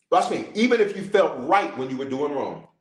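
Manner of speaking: normal talking voice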